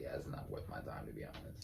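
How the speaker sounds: low unvoiced whisper; whispered aside. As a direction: whisper it, dismissive. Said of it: "and I was like".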